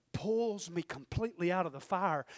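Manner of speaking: normal talking voice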